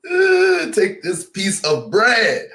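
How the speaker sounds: high, slow, loud solemn priest voice; mock priest. Imitating the priest handing out communion bread, hammed-up. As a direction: intone it like a priest at mass.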